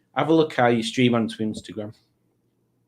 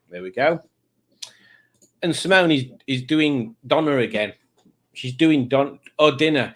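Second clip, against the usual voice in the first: ordinary delivery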